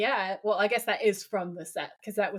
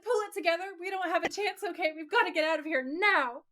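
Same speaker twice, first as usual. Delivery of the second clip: mock frantic B-movie actor, pitched much higher; hammed-up panic; 8 semitones higher than usual. Brody the actor panics at Wendy, hammed-up higher frantic voice. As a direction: overact the terror like a bad horror actor. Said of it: "And Brody's like".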